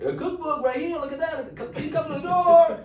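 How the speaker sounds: high, loud coaxing with a laugh; coaxing call. Retold or imitated. Man showing a cookbook through the window, loud high coaxing. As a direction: sell it loudly through the glass.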